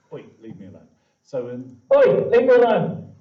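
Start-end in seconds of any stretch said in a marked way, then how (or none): 1.9-3.2 high, loud squeaky cat yelling at you. Shown as a demonstration. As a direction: shriek it like an annoyed cartoon cat.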